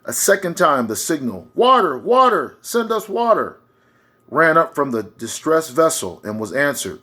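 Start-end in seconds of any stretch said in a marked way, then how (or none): 1.5-3.6 loud, high cry for help, slowing on the last words; a parched crew crying out for water; desperate call. Acted. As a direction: shout it across the water like a dying sailor. Second distress signal from ship.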